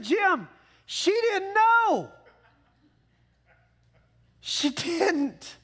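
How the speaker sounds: very high, slow, drawn-out insistence on "know"; emphatic retort. Real, not acted. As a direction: hammer home "she didn't know" to Jim. Preacher telling Jim emphatically.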